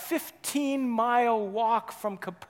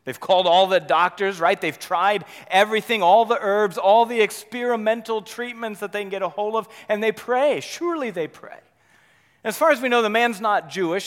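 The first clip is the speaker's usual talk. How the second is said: building, louder preaching; 3 semitones higher, 7 dB louder than usual. Acted. Preacher building to a loud pitch before the congregation. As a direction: drive the list home to the congregation.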